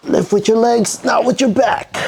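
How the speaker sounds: strained, straining under the load; forced out while lifting something heavy; effort grunt. Real, not acted. Lifting heavy wall alone.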